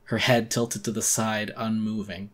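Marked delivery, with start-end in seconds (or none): none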